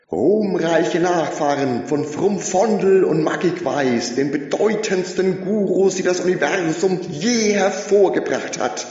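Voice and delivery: high, loud, ringing proclamation; loud crowd address. A narrator's reading. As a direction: whip up the crowd from the podium. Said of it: "rief er".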